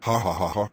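a mock villain cackle in a lightly altered voice; evil laugh. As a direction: gloat over the revenge plan. Plotting revenge on babysitter.

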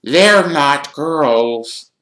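high, very slow accusation; whiny ghost girl. Acted. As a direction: stretch out every word, suspicious and high. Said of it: "eyeing Ron and Harry suspiciously".

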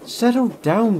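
loud, high scolding; a loud, sharp scold. A narrator's reading. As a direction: rein him in sharply. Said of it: "scolded the driver".

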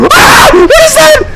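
high panicked shouts; frightened screaming. Real, not acted. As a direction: shriek in fright. Frightened by monster.

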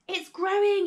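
high, loud yell; excited yell. A narrator's reading. George yelling to Grandma as the hen grows, excited high yell. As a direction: yell the news upward. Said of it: "he yelled".

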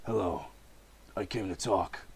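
lightly voiced Batman. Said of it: "Batman speaks".

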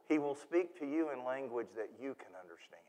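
hushed, breathy whisper to the congregation. Real, not acted. Preacher whispering a promise to his congregation. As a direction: lean in and whisper it softly.

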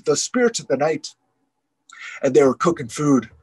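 plain reading voice

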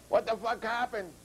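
mild mock scream. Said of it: "screamed Red".

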